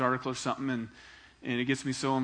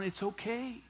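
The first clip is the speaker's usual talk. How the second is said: gentle reassurance; 2 semitones higher, 6 dB quieter than usual. Acted. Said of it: "with a smile".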